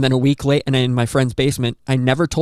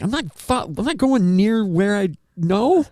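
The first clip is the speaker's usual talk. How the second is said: scared refusal; 10 semitones higher than usual. Retold or imitated. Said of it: "And I was like".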